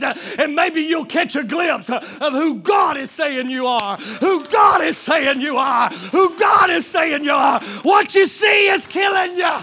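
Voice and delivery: rising from plain to loud high shouts; building to full-force preaching. Real, not acted. Preacher building his climax from speaking to shouting. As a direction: climb in power line by line.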